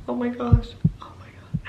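whispered oh my gosh; awed whisper. Real, not acted. Vlogger marveling at cute minis, whispering. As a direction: breathe it in awe. Awed over mini products.

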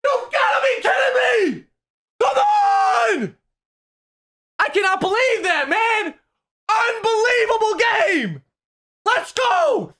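screaming high with disbelief and joy; ecstatic shouting. Real, not acted. Gamer after a wild comeback win, ecstatic shouting. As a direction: let it all out, the game's won. Winning the game in overtime.

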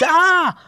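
high, loud startled scream; real scream. Real, not acted. Gamer scared by the game, real scream. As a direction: shriek at the jump.

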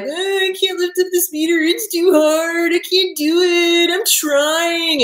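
high whiny complaint, words dragged out; Luke Skywalker. Acted. Luke complaining to Yoda in the swamp, exaggerated whining. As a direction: whine it like a defeated trainee. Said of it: "Luke is just a whiny ass bitch".

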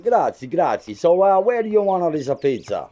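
light hammed-up Italian accent; comic put-on Italian accent; fake Italian accent. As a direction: stay in the cheesy Italian act. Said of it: "They just forced me to do the accent".

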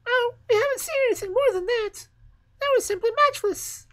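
very high, loud chirpy bird voices; twittering sparrows. Acted. The sparrows boast to the fir tree, very high and chirpy.

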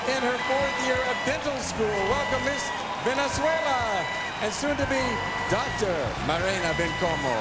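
high, bright host introduction; pageant announcer. Acted. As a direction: build excitement for the next contestant. Host introduces Miss Venezuela.

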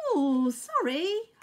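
high, slow, loud bee apology; Honeybee. A narrator's reading. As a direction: sing out a little bee's apology. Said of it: "hummed Honeybee".

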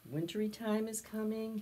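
ordinary delivery, nothing marked

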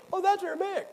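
high, sneering mock voice of a translator.

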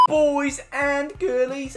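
slow drawn-out host address; host greeting. Acted. Gamer greeting his viewers, slow showman address. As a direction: stretch it like a showman. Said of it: "drawn-out host address".

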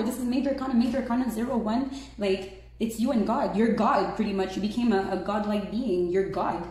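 normal talking voice

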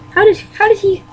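high, disbelieving question; amazed stammer. Acted. Mario asks how he got them, high and amazed. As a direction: still can't get over it.

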